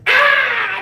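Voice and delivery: sudden loud high yelp; loud high yelp of pain; mock scream. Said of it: "I just started screaming".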